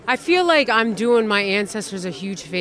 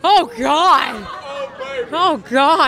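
real horrified exclamation, pitched higher; horrified outcry; 5 semitones higher, much slower than usual. Real, not acted. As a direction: cry out, appalled and half laughing. Horrified at brother called boyfriend.

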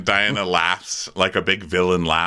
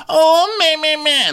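very high, louder fake wail; mock whining; 6 dB louder, slower than usual. Mocking people who list their traumas, fake whining. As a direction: whine it like a hammed-up sufferer.

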